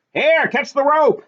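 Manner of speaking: slow, high shout down to someone in the water; shouted call. A narrator's reading. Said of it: "someone high up shouted".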